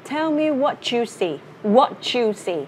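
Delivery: "what you" joined into "wha-chu"; slow model line with "what you" as "whatchu"; linked sounds. Shown as a demonstration. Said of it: "nó sẽ là âm ch".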